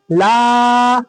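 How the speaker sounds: "ला" held long on a level note; long vowel. Shown as a demonstration. Teacher telling learners to stretch the laam, long vowel. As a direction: stretch out "ला" steadily. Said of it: "लाम को खींचे".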